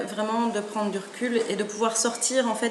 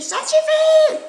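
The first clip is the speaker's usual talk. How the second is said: much higher, loud furious yell; angry shout; 10 dB louder, much slower than usual. Acted. Mother hen finally yells at her squabbling chicks. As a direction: blow up at the kids. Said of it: "elle crie".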